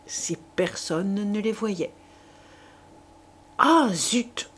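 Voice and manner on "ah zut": mild shout. A narrator's reading. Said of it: "cria Chouteau".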